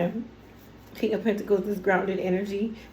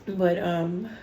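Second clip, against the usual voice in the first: usual speaking voice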